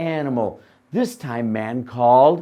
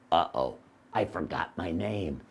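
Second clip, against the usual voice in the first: dopey donkey; 9 semitones lower, 8 dB quieter than usual. Acted. Donkey forgets again.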